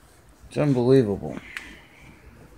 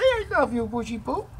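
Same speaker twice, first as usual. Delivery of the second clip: 1 high coaxing; 9 semitones higher, faster than usual. Shown as a demonstration.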